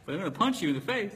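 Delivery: rapid joking threat. Retold or imitated. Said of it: "I'm like".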